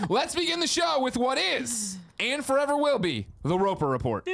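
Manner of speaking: dramatic announcer intro; segment announcer. Acted.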